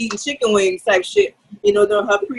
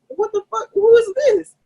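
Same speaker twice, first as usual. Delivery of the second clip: startled outburst; 13 semitones higher, slower than usual. Retold or imitated. Knocking the mask away from her face, shocked high outcry. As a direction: jolt up in shock at the stranger.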